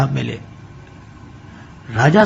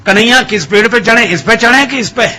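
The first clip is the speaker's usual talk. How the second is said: calling out loudly and higher from the tree; 6 semitones higher than usual. A narrator's reading. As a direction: shout the question up high, not caring who hears. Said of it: "जोर से बोलने लगे". (ऊँची आवाज़ में ज़ोर से पुकारना)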